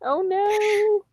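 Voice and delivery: high, loud oh no; high dismayed squeal. Real, not acted. Reacts to bad reviews.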